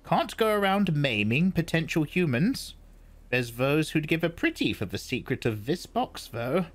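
put-on high, measured old woman's voice; old Reverend Mother. A narrator's reading. Old woman explains the box to Paul, high and measured. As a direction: speak as the stern old crone.

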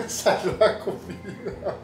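slow, drawn-out mocking voice, laughing; lightly mock a silly put-on voice. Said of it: "le pone una pinche voz de". (voz burlona y lenta, riéndose)

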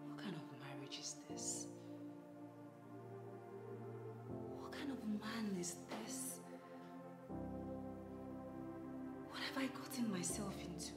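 soft, whispered, despairing musing; whispered lament. Acted. Wife alone after the fight, whispering her sorrow. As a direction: confide your hurt under your breath.

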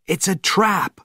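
high tense warning; urgent warning. A narrator's reading. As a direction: warn him sharply. Conklin warns Panov.

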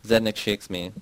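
ordinary delivery, nothing marked

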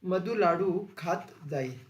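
slow, word-by-word dictation with a stress on laddu; slow teacher dictation, one word at a time. Shown as a demonstration. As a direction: dictate it slowly so the class can write it down. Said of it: "आणि रीती भविष्यकाळ कसा होईल?".

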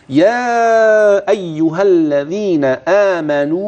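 slow, with stretched madd; teacher reciting the next verse; Quranic recitation. Shown as a demonstration. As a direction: chant the verse slowly with clear madd. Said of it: "انتبه لأماكن المد والغن".

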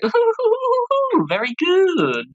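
very high, hooting jester voice; high, silly juggler voice; gleeful juggler. Acted. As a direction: hoot with hammed-up delight. Juggler's delighted reply to getting money.

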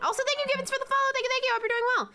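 high cheery streamer thanks; bright thank you. Real, not acted. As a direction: thank the follower warmly and high.

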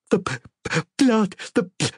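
high, stammering through hiccups; hiccuping stammer. A narrator's reading. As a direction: choke out the word in shock. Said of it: "she hiccuped".

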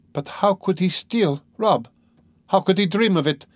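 high, incredulous protest; crying out. A narrator's reading. Dunya cannot believe her brother would steal, crying out. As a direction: cry out in disbelief, pitched well up. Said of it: "cried Dunya".

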